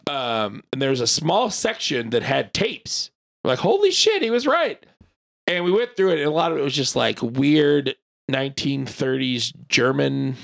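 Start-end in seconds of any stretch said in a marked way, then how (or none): 3.6-4.8 high-pitched retold astonishment; mock-amazed outburst, much higher than usual; amazed reaction. Storyteller recalling the find of tapes, amazed.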